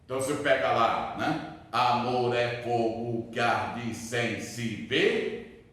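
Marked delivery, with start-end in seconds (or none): 1.7-5.7 slow, measured scanning with evenly spaced syllables. Shown as a demonstration. Teacher scanning a Camões line for class, syllable by syllable. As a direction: count out the verse syllable by syllable. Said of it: "eu tenho que contar".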